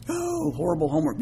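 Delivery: very high-pitched whiny groan; hammed-up dread in a high voice; mock complaining schoolkid. Voicing schoolchildren dreading homework.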